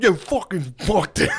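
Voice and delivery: mock upset outburst, pitched high; upset grumbling. Upset comics in the car after bombing, high mock cursing. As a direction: imitate fed-up cursing in a high voice. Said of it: "they were so upset".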